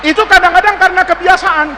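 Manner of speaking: usual speaking voice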